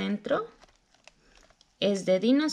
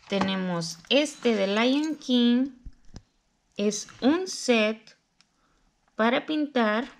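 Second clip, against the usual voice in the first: usual speaking voice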